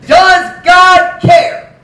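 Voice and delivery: booming preacher shout. Acted. Preacher shouting the question to his congregation. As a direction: shout it slow and loud, drawn out.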